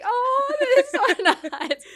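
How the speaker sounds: very high, loud gushing through a laugh; American mom gushing. Retold or imitated. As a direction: coo it like a delighted mom, laughing. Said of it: "it's like".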